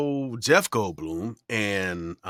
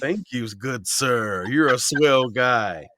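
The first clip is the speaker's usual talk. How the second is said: mock formal thanks; 2 semitones higher, 5 dB louder, slower than usual. Thanking page-flipper in mock formal voice.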